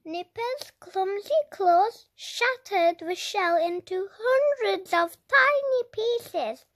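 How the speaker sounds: ordinary delivery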